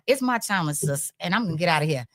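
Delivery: ordinary talk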